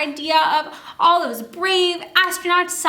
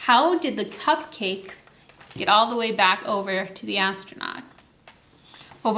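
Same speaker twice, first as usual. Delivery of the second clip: ordinary delivery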